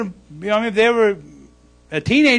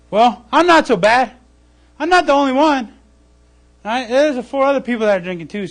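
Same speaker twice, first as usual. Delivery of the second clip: louder, higher, defensive excuse-making; self-justifying; 5 semitones higher, 7 dB louder than usual. Retold or imitated. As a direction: brush it off like it's no big deal. Sinner excuses himself aloud.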